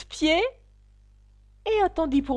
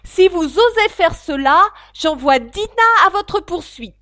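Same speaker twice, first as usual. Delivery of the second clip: cri de toutes ses forces; 7 semitones higher, 13 dB louder than usual. Acted. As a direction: menace criée, aiguë et forte. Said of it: "Alice cria de toutes ses forces". (Alice menace les animaux en criant fort)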